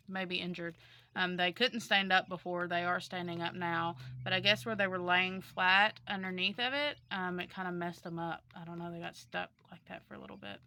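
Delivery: ordinary talk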